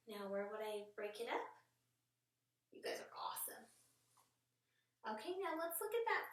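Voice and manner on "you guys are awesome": soft whisper to the class; hushed pleased whisper; whispered praise. Real, not acted.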